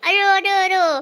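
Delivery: hoarse, strained voice from yelling. Real, not acted. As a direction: voice rough from shouting. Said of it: "Suaraku jadi serak gara-gara teriak-teriak".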